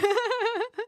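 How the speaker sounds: high burst of laughter; high giggling at herself; amused laugh. Real, not acted. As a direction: laugh at your own failed attempt. Laughs at own failed accent.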